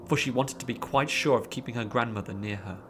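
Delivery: ordinary delivery, nothing marked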